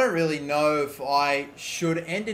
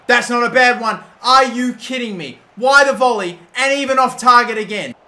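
louder, higher disbelief at the miss; exasperated outburst; 7 semitones higher, 11 dB louder than usual. Real, not acted. Gamer groaning at a wasted volley, exasperated shout. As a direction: throw up your hands at the volley.

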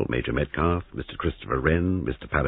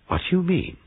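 sharp, much higher startled question; jolted into asking, voice jumping up; alarmed question; 14 semitones higher than usual. Acted. Giles, startled by Trotter, asking in high alarm.

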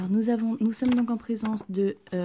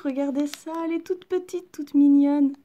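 delighted; 6 semitones higher, 8 dB louder than usual. Real, not acted. Last booster, full set.

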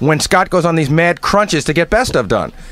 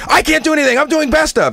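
high-pitched, panicky imitation of a coworker; mock panic; 8 semitones higher than usual. Colleague mimics the engineer overwhelmed by best of, mock panic. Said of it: "then he's in a panic".

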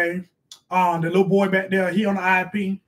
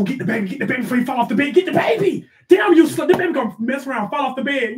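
urgent scolding yell; 5 semitones higher, 3 dB louder than usual. Real, not acted. Mom yelling at kid to grab baby.

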